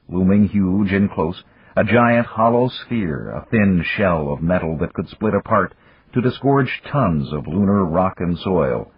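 ordinary delivery

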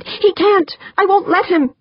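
high-pitched, outraged cry; shouted protest. Acted. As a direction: exclaim in defiant outrage. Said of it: "Wonderly shouted".

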